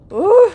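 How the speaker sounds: high, loud short "uh"; sudden high startled cry; startled whoop. Real, not acted. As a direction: yelp at a near miss. Near miss during game race.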